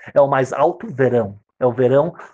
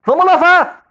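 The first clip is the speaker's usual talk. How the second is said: much higher, louder urgent repeat; rising panic; 13 semitones higher, 6 dB louder, slower than usual. Acted. Father hurrying to wash the baby, high urgent. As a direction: push it up in alarm.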